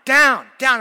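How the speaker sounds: very high, shouted imitation of a toddler; toddler tantrum. A toddler demanding to get down, imitated tantrum. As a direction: mimic a small child's repeated wail of protest. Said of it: "she's throwing a tantrum".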